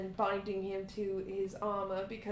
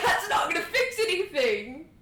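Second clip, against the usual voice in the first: louder, laughing protest; laughing exasperation; 6 semitones higher, 6 dB louder than usual. Real, not acted. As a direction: laugh while scolding a friend's silly choice. Scolding friend's rash spell.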